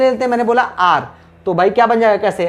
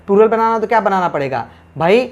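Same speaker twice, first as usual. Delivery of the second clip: ordinary delivery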